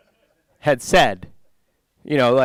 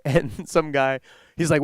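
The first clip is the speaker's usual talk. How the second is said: usual speaking voice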